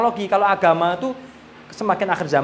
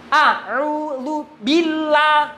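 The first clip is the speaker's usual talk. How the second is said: slow drawn-out tartil chant, higher; Quran recitation; 8 semitones higher, much slower than usual. Shown as a demonstration. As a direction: stretch each syllable like a reciter. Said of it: "Perhatikan sekarang".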